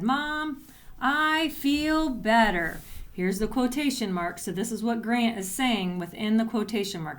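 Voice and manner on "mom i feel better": a bit higher and slow, brightening up; a boy gladly telling mom; excited boy. Acted. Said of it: "He said".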